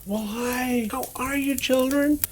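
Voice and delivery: put-on high, sing-song hello to kids; cheery greeting. Santa greeting children on a video call, high and cheery. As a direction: greet the little ones brightly.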